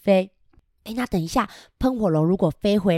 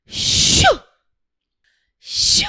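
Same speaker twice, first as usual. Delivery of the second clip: whoosh sound; 6 dB louder than usual. Acted. Dragon flying again.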